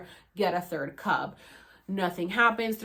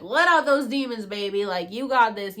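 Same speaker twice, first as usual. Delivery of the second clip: mock cheer; 2 semitones higher, 7 dB louder than usual. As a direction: egg him on, hyped up. Cheering him on.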